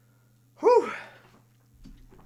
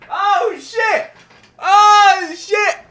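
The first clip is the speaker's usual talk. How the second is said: ordinary delivery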